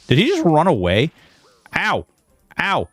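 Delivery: ordinary delivery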